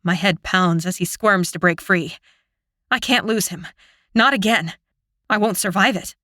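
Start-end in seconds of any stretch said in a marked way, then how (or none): none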